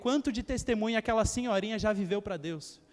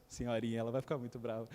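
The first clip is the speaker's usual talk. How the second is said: quiet aside; 12 semitones lower, 7 dB quieter, faster than usual. Real, not acted. Son teasing his watching mother, quiet aside. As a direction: lower and much softer, half to himself.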